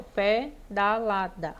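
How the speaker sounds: "pedalada" in four separate beats, slowly; separação silábica. Shown as a demonstration. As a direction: break pedalada into pe, da, la, da. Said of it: "quatro emissões de voz". (pedalada sílaba por sílaba, devagar)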